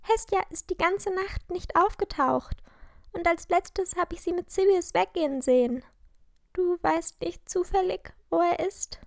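high voice, troubled telling; worried girl character. A narrator's reading. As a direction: voice a schoolgirl reporting her missing friend. Narrator voices Lilly in dialogue.

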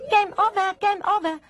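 very high-pitched toy squeal; high cry. Acted. Charlie Chip cuts in on the doll, crying out. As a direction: cry it out like an alarm. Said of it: "gridò Charlie Chip".

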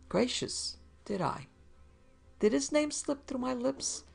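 high, fussy fake surprise; mock surprise. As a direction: pretend to be shocked. Fussy man feigning surprise.

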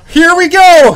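loud, high, thrilled; excited shout. Real, not acted. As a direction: shout it out as it lands. Booster coming in.

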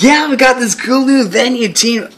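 high, loud mock promo voice; imitating a pumped-up church promoter; church advert. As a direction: hype it up like an over-eager church ad. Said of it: "they're always advertising".

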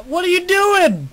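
very high, loud disbelief; shrill outrage. Real, not acted. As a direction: shriek it at the friend. Friend shoots him in the game.

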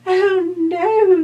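very high, loud frightened fly cry; housefly. Acted. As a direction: squeal it out in alarm. Fly cries out.

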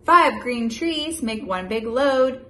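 lively, loud picture-book read-aloud; animated storybook voice for a little one; reading to a child. Acted. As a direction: read it big and bouncy for a toddler.

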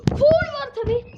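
sudden loud yell of protest; angry shout. Real, not acted. Gamer yelling at the referee, angry shout. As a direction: bellow it at the ref.

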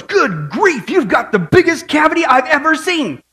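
half-shouted astonishment, retold; mock shout. Dentist shouting to Bill about his cavity, mock shout. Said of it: "he shouted to Bill".

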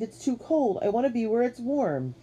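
high-pitched whine; childish complaint. A narrator's reading. Katie freezing in the snow, whining complaint. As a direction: keep whining like a cold little kid. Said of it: "she complained".

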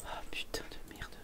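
unvoiced, under-the-breath swear; whispered curse. Real, not acted. Streamer cursing at the stuck sim, whispered.

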